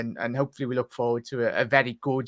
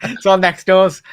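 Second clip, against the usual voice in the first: laughing; 7 semitones higher, 12 dB louder, slower than usual. Real, not acted. Laughing at the bad Scouse Wi-Fi.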